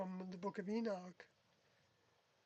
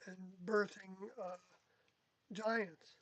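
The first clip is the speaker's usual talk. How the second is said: ordinary delivery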